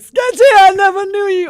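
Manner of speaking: put-on high, loud croaky old man. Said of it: "The old man voice".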